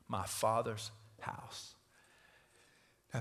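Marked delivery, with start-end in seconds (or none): 0.1-1.8 slow, soft, drawn-out "My Father's house"; hushed slow emphasis on "Father's house"; weighty stress. Shown as a demonstration. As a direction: linger on every word of "My Father's house". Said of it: "he uses these two words".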